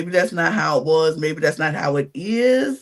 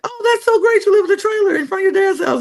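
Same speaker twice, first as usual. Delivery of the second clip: much higher, fast, over-the-top cheery praise; mock gushing Kyle; 12 semitones higher than usual. Telling her co-host how Kyle reacted, in a high gushing mock voice. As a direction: gush it like a clueless rich guy being upbeat. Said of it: "Kyle was like".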